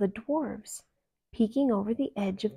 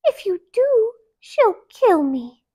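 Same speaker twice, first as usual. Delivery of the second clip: frightened plea; 13 semitones higher, 10 dB louder than usual. A narrator's reading. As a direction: warn them in fear.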